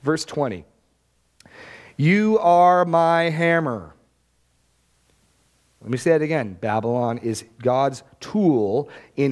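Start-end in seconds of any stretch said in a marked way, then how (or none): 1.9-3.9 slow, drawn-out declaration as God; sovereign God. Acted. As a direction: proclaim it slowly, with absolute authority. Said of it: "God speaks of them in such absolute sovereign".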